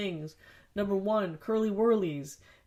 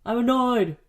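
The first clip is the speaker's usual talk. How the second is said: louder, higher "I'm annoyed!" burst; annoyed outburst; 6 semitones higher, 9 dB louder than usual. Real, not acted. Fed up with recording on the wrong mic side, loud frustrated outburst. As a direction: let "annoyed" explode in frustration. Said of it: "Honesty time".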